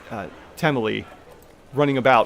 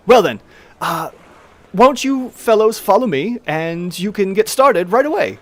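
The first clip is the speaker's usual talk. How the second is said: higher, louder halfling host, brisk; Tamili the halfling; 6 semitones higher, 5 dB louder than usual. Acted. Halfling owner telling adventurers to follow her, higher voice. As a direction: usher them along cheerfully.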